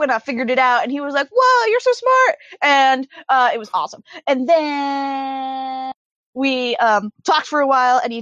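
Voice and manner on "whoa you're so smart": high-pitched imitated awe; mock amazement. Retelling a friend's reaction to her cleverness, mock amazed. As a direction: gush in playful, put-on amazement.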